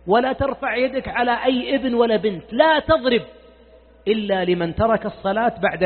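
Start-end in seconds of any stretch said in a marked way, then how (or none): 2.5-3.2 لا تضرب with لا hit hard and louder; emphatic لا تضرب. Real, not acted. Preacher commanding fathers not to hit, emphatic. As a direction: drive home لا as a firm command. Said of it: "وأقولها بصوت عال".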